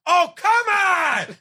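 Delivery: high, drawn-out exasperated shout; hammed-up groaning fan; mock yell at the screen. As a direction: wail your disbelief at the screen. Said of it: "I was just yelling at the screen".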